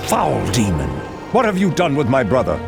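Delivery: high, urgent, angry challenge; outraged accusation. Acted. Warrior accusing a demon over his brother, outraged. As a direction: confront a foe, pitch thrown up.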